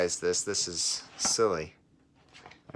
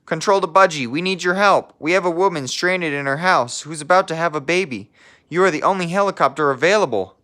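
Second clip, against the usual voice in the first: higher, louder, pressing dispatcher call; urgent radio dispatcher; 9 semitones higher, 7 dB louder than usual. Acted. Control tower radioing the helicopter pilot for help, higher and louder urgent call.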